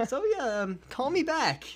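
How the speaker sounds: high-pitched, hammed-up phone message; mock awkward sign-off. Killer ending message to his lawyer, mock awkward.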